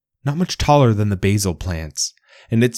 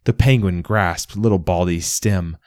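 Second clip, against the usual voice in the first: ordinary delivery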